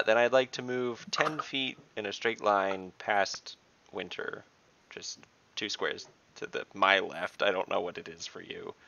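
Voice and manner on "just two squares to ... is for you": ordinary talk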